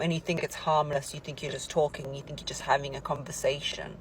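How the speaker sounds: ordinary talk, nothing marked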